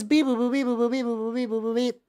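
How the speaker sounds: beep-boop robot chant; sing-song machine noises; toy robot voice. Pretend. Spinning the update wheel.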